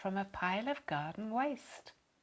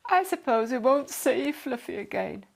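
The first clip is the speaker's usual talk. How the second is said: mournful; 3 semitones higher, 5 dB louder than usual. A narrator's reading. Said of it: "Billy said mournfully".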